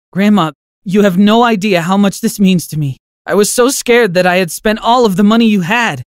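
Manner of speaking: high, heartfelt thanks; relieved grandson. Acted. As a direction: pour out relief while hugging her. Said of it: "hugging her tightly".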